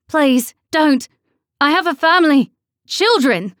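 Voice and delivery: high, loud desperate begging; terrified pleading. A narrator's reading. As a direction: beg for your life, panicked. Victim begs for her life.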